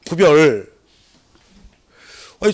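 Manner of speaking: ordinary talk, nothing marked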